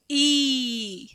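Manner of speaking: ई held out long. Shown as a demonstration. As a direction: stretch the ई sound. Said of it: "थोड़ा time लेके इसको बोलते हैं".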